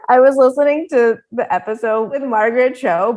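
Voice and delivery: normal talking voice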